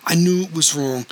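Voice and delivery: crying out. A narrator's reading. Cousin confesses to the betrayed wife, mildly crying out. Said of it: "cried Robin".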